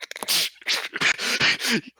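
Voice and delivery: high breathy burst of laughter; real laugh. Real, not acted. Game master laughing at the head pat.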